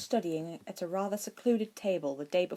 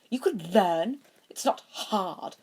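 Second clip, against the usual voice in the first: dismissive; 3 semitones higher, 4 dB louder, slower than usual. A narrator's reading. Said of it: "Draco said dismissively".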